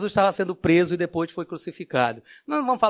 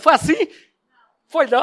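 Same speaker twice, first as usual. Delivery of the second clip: mock question; 10 semitones higher, 7 dB louder than usual. Teasing audience about the story.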